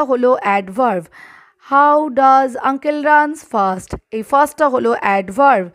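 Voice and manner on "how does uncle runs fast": very slow classroom drill. Shown as a demonstration. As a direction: stretch every word. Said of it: "drilling example questions".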